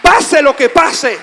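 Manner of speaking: very loud, high proclamation; shouted preaching climax. Real, not acted. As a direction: roar it out to the congregation. (clímax de predicación a gritos)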